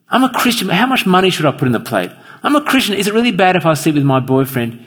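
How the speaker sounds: a hint of someone hunting for the minimum; questioning churchgoer. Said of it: "throwing up these questions that are almost meaningless".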